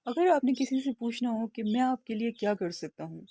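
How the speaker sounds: ordinary delivery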